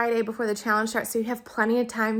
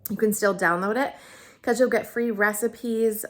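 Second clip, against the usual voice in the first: usual speaking voice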